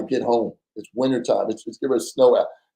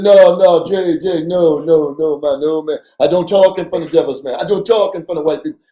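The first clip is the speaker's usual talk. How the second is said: heated refusal; 4 semitones higher, 5 dB louder than usual. Retold or imitated. Partner refusing to talk openly.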